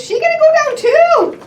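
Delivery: playful high query; excited question. Pretend. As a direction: build suspense for the child.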